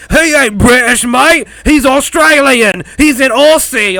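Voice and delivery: loud, high Australian accent, hammed up; exaggerated Australian protest, imitating online critics; mock outraged Australian. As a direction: yell like an indignant Australian commenter. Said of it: "everybody was like".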